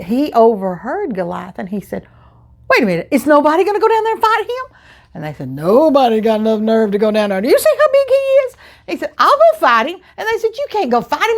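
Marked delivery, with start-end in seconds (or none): 2.7-4.8 higher, fast eager question; a boy blurting it out in disbelief; young boy voice. Retold or imitated. David asking the soldiers, high and fast. 5.5-8.6 scared soldiers. Retold or imitated.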